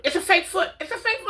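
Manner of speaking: rising high repeats of fake foot; self-reassurance. Telling herself the foot is fake, pleading and high. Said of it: "kept trying to tell myself".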